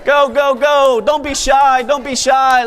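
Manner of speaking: loud, high shouts over the crowd; shouting. Real, not acted. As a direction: call out over the noise.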